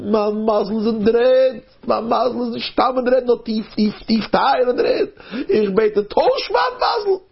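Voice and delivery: high, drawn-out acted plea; pleading prayer. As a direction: cry out your plea to heaven. Man pleads to God on mountaintop.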